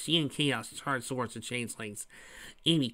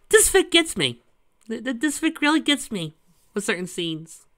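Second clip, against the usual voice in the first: higher, louder, stumbling with enthusiasm; excited gushing; 10 semitones higher, 6 dB louder than usual. Real, not acted. Reader telling the audience the fic gets them, excited. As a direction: gush about loving the story.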